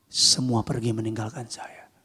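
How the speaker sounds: unvoiced hush of abandonment; a lonely whisper from the cross. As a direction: breathe it out in a whisper. Jesus voiced, whispered line.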